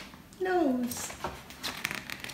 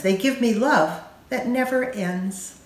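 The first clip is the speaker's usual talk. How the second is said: usual speaking voice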